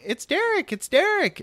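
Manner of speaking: very high-pitched announcing voice; imitated excited Isaac. Boy at the window reporting who's outside, imitated high excitement. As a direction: squeal the news in a high mimicking voice.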